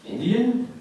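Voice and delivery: high puzzled guessing, mock bafflement; confused stranger. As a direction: play the baffled shopper trying to solve him. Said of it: "Just like".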